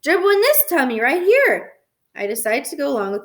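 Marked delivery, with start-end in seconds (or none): 0.0-1.7 high, loud little-brother voice; proud toddler. A narrator's reading. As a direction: show off like a gleeful small child. Toddler proudly shows his stomach.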